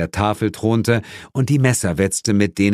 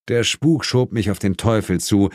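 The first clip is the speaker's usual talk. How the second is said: ordinary delivery, nothing marked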